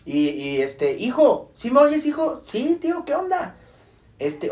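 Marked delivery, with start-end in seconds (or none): 1.0-3.6 high voices calling down the line; raised, high-pitched quoted exchange; acted phone call. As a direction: imitate checking a bad connection. Uncle and nephew on bad phone line.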